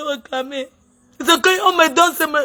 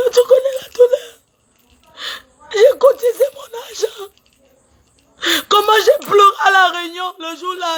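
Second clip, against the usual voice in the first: high, crying plea half-laughing through tears; weeping over money she paid in; real sobbing; 4 semitones higher than usual. Real, not acted. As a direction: sob it out, voice breaking high. Sobbing about her contribution.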